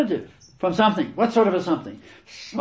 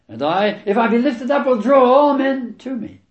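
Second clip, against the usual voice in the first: proclaimed loudly; 10 semitones higher, 7 dB louder than usual. Acted. Preacher proclaims Jesus' words.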